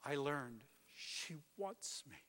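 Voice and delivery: normal talking voice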